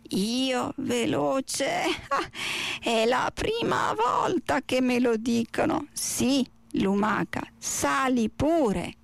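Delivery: very slow, drawn-out tortoise voice with a chuckle; slow, amused tortoise. Acted. Tortoise answering the snail who finds her too fast, slow and amused. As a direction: draw each word out slowly, amused at being called fast.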